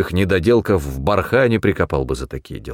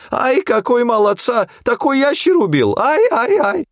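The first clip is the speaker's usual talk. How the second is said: put-on very high, accented falsetto wail; wailing lament; 3 dB louder than usual. A narrator's reading. Джамшит fussing over the hunter's kill, high wailing. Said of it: "причитал Джамшит".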